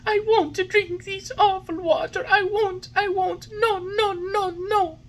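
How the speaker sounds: high, loud wailing refusal; crying tantrum. A narrator's reading. Puppet crying as he refuses the medicine. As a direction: bawl it out like a child. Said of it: "bursting out crying".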